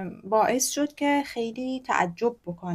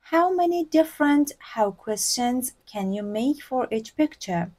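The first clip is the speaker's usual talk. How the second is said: slow model reading; 3 semitones higher, much slower than usual. Shown as a demonstration. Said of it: "چه تعداد".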